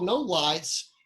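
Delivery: ordinary talk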